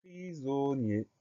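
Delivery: drawn-out syllables at half speed; slow dictation. Shown as a demonstration. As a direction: present the word slowly, syllable by syllable. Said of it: "c'est le mot".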